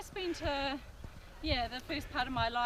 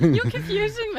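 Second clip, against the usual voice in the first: laughing; 11 semitones higher, 9 dB louder than usual. Real, not acted. Giggling during camera play.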